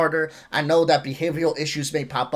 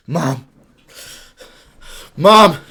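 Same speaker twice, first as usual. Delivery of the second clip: yelling across the room; 9 dB louder than usual. Acted. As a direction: kid yelling for mom. Acting a kid calling for mom.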